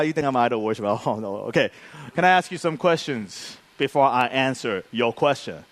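low, soft, controlled challenge; restrained comeback. Retold or imitated. Pastor answering his accuser with questions, restrained. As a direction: drop it low and hold the temper in.

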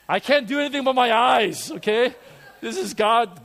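ordinary talk, nothing marked

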